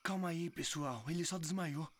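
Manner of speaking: calm. Acted. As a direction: settle everyone down gently. Calming friends.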